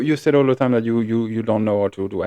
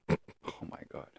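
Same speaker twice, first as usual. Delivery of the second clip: whispered exasperation; 21 dB quieter, slower than usual. Real, not acted. Exasperated aside.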